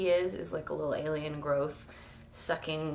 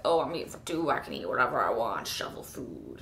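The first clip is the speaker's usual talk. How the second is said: mocking voice; 7 semitones lower than usual. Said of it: "That, like, whole phrase of".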